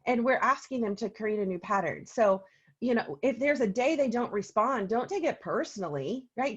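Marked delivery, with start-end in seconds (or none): none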